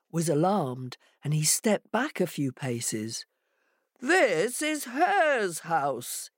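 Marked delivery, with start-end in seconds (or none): 4.0-6.3 slow, high-pitched indignant call; shouting jackal. Acted. Jackal at Hare's door protests, shouting. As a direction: call it out with every word stretched. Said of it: "he shouted".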